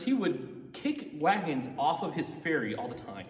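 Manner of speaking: ordinary delivery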